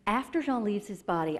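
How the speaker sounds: usual speaking voice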